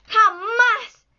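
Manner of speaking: high, loud cry of defiance; sudden high shout; shouted refusal. A narrator's reading. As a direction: cry it out, pitched well up. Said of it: "gritó".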